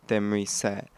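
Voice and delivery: ordinary talk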